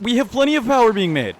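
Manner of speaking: high, loud disbelief at the game; incredulous protest. Real, not acted. Gamer protesting a no-power warning, high incredulous. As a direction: pitch jumps up in outraged disbelief.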